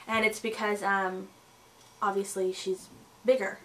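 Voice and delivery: ordinary talk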